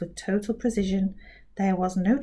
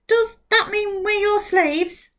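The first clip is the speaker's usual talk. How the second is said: Captain K's voice; 11 semitones higher, slower than usual. Acted.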